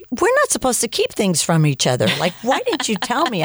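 high-pitched, retold indignation; mock protest. Husband to wife who kept news from him, mock-indignant. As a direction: protest it high, imitating a reproach.

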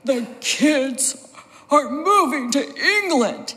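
imitating a distraught father, swooping pitch; mock anguished announcement, drawn out in swoops; upset dad. Dad breaking the news to mom about England, upset. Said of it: "my dad was looking really upset".

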